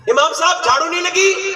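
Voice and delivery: high, ringing sermon delivery; impassioned preacher voice; fiery preaching. Real, not acted. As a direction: preach it out to the crowd. Preaching at full force.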